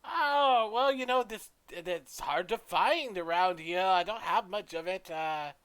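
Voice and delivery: high, loud character voice; forgetful shopkeeper, haggling. Acted. Shopkeeper talking up a scarce leaf, high and loud. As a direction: open high and loud, then hedge.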